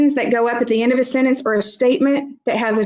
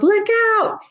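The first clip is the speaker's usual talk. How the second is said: yelled warning; 11 semitones higher than usual. Acted. Somebody yelling to a child on the baseball field, demonstrated warning yell. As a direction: call out a warning, pitch jumping way up. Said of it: "somebody yells".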